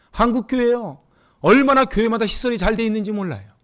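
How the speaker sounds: impassioned pulpit rhetoric; fervent preaching. Acted. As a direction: drive the point home to the pews. Pastor preaching to congregation.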